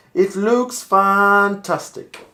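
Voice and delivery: "fantastic" stretched long and loud; drawn-out emphasis. Shown as a demonstration. Voiceover warm-up, repeating a line.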